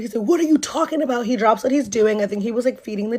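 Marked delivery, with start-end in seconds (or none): 0.2-1.3 quick, high mock bewilderment; feigning ignorance. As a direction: imitate a boyfriend playing innocent. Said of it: "like, acting stupid".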